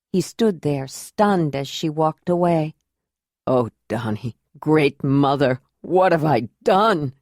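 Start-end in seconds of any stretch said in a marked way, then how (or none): 3.5-7.1 lower, a mild anguished outcry; a touch of despair breaking out; anguished cry. A narrator's reading. Jondalar alone after Ayla leaves, crying out in anguish. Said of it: "Jandalar cried out in anguish".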